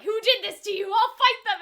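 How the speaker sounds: high, loud protective vow; angry threat. Retold or imitated. Jack vowing to avenge Legacy, loud angry threat. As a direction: bark it like a furious friend. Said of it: "he's even, like, angry".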